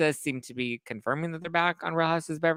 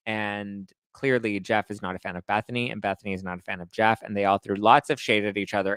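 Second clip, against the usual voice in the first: normal talking voice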